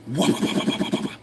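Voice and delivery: unvoiced flapping noise; window blinds noise. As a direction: imitate blinds rolling up fast. Imitating window blinds snapping up.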